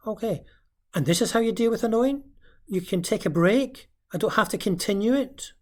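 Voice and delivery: put-on a child checking the new rule; child's voice. Parent voicing the child asking about taking a break.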